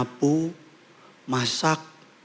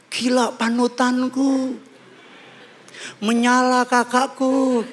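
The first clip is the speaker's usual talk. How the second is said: high, drawn-out mock fan praise; exaggerated admiring follower, pitched way up; gushing online commenter; 10 semitones higher, slower than usual. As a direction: fawn over an idol in a sing-out high voice. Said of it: "Langsung komen".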